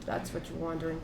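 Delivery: very soft, low and private; whispered confiding. Acted. Player quietly answers Gideon's question in private, hushed. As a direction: keep it under your breath, just for him. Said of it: "he just whispers to him".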